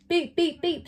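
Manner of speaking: high clipped beeps; robot beeps. Acted. The magic robot greets Winnie, with high beeps. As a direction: beep like a toy robot. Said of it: "said the robot".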